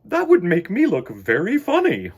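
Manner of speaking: picture yourself with wings and laugh at it; laughing mother bear. Acted. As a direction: Missus Bear laughing at looking funny. Missus Bear character voice.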